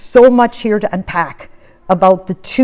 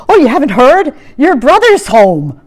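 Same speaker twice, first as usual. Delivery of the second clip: excited servant; 6 semitones higher, 4 dB louder than usual. Retold or imitated. Servant tells the news.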